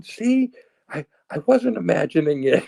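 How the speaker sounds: high-pitched, told-you-so protest; vindicated son. Retold or imitated. Son looking at his mom after the confession, vindicated. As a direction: plead your case to mom in a high voice. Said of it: "I'm looking at my mom going".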